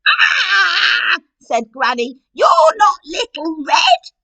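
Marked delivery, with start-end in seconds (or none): 0.1-1.2 high, loud frightened shriek; startled scream. Acted. Granny seeing the wolf, high startled shriek. 2.3-4.1 high, loud, slow frightened shout; alarmed Granny. Acted. Granny realising the wolf tricked her, loud slow shout.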